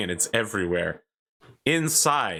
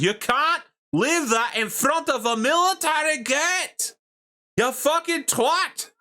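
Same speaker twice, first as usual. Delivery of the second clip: very high, outraged Scottish shouting; shouting Scottish man; 3 dB louder than usual. Acted. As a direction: yell it in a thick Scottish accent, drawing words out. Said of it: "And he goes".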